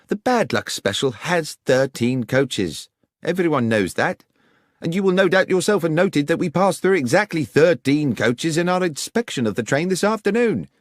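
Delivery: a lightly voiced, confident inspector; Inspector Hopkins objecting. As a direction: correct Holmes with the obvious count.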